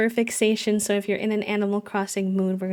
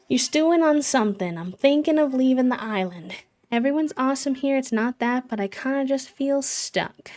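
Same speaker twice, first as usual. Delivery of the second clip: higher-pitched villager voice, confiding; lightly voiced character, pitched up, unburdening; restless villager; 5 semitones higher than usual. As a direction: read it as a game villager who feels stuck. Reads villager Hazel's dialogue aloud.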